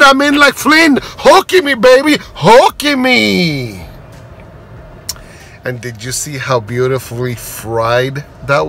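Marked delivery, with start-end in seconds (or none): 0.0-3.8 high, loud, giddy delight slowing at the end; giddy retold cheer. Telling the server he's thrilled with the fish, giddy delight. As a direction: sing it out like you won the jackpot. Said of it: "And I said".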